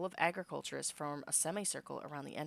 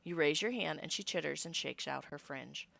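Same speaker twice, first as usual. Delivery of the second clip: ordinary delivery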